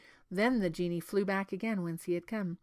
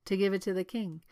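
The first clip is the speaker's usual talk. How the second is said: ordinary delivery, nothing marked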